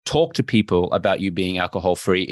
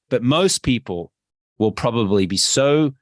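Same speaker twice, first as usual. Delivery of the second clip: ordinary delivery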